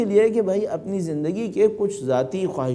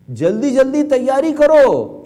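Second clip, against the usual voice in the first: slow, higher, much louder call to the mosque; loud proclamation; 7 semitones higher, 8 dB louder, much slower than usual. Retold or imitated. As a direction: boom it out slowly like a call heard by the neighbours. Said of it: "وہ مسجد میں اتنی زور سے بولتے تھے".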